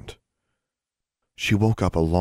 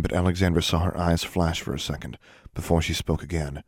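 usual speaking voice

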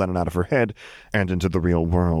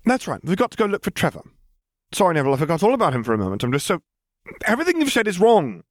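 higher, fast flustered exit line; offended girl storming off, higher and rushed; huffy girl; 11 semitones higher, faster than usual. Acted. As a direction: rush the words out, then snap the last. Hermione leaving in a huff.

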